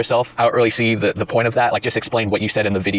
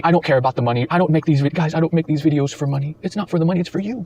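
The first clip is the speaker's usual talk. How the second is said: higher, whiny mock sincerity; higher mocking fake-humble YouTuber voice; 6 semitones higher than usual. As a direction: parody a YouTuber pretending money doesn't matter.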